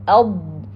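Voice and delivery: "Al-" closed on a held Arabic Dad; articulation demo. Shown as a demonstration. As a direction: press "Al-" into a sustained heavy d sound. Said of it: "Behind upper incisor".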